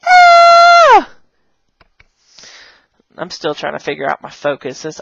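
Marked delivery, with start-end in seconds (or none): 0.1-1.1 very high, loud sustained "Ah"; excited squeal. Shown as a demonstration. Teacher thrilled by the headphones prop, high squeal. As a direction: shriek with put-on delight.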